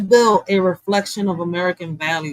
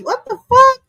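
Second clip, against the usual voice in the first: very high, loud what the fuck; squealed disbelief; 4 dB louder than usual. Real, not acted. Streamer baffled by bill acronyms, squealed disbelief. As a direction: shriek of exasperation.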